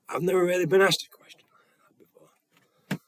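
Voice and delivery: breaking into a whisper; retold hushed, whispery answer; hushed amazement. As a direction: imitate a man taken aback, voice dropping to a whisper. Retold stunned answer from the Father.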